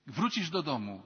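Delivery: usual speaking voice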